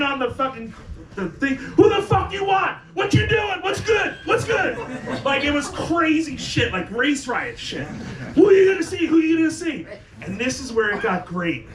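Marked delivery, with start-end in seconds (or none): 1.6-4.8 high, loud shouting at the car; imitated street shouting. 8.4-9.9 high, loud shouting at the car; imitated street shouting. Men demanding at car window.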